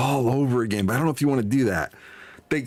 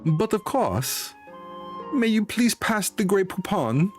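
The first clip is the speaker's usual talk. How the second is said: higher, drawn-out parody of a fancy gentleman; mock-refined impression; 8 semitones higher, slower than usual. Streamer parodying the game merchant's "But of course", mock-refined and drawn out. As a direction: put on exaggerated dinner-table politeness, pitched up.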